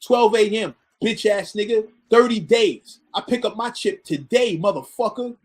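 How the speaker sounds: imitated emphatic, punched-out taunts; angry cursing. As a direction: act out an angry winner claiming his bet. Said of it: "he's cursing at me".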